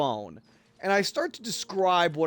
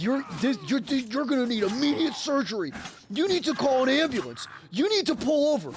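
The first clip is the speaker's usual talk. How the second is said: higher, urgent imitation of a panicking brother; frantic brother; 6 semitones higher than usual. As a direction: rattle off orders like a panicked sibling. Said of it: "My brother just goes".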